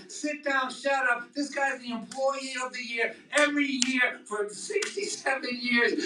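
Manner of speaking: high, scolding rant; high mock-angry rant at his lungs and kidneys; telling off the organs. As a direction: shout them down like a boss defending his best worker. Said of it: "And I'm like".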